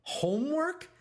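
high, loud outraged shout. A narrator's reading. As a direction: shout it in disbelief. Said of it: "shouted Pop Fly".

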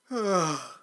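loud falling yawn; big yawn. A narrator's reading. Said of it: "He yawned".

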